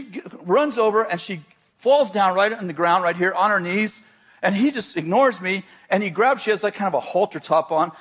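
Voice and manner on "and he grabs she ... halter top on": usual speaking voice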